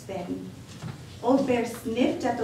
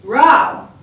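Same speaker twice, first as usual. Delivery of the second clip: loud, higher roaring bellow; a big loud bear's roar; 4 semitones higher, 14 dB louder than usual. Acted. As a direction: roar like an angry bear. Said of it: "Old Bear roared".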